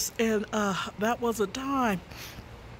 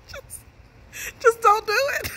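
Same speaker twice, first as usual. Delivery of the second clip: laughing; 11 semitones higher, 3 dB louder than usual. Retold or imitated. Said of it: "He would tell me".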